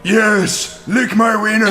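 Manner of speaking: strangled-throat anime villain voice; Vegeta voice. Retold or imitated. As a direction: squeeze the throat like a cartoon villain. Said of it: "I have to strangle myself and be like".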